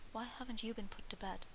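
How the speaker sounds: soft, mildly exasperated mother; hushed, fond scolding; indulgent, exasperated. A narrator's reading. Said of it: "at once indulgent and exasperated".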